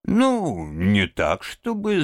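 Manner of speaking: high, awkward, halting reply; hesitant faltering. A narrator's reading. Rat denying he is fed up, hesitant faltering. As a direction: hedge and falter in embarrassment. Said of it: "замялся Крысси".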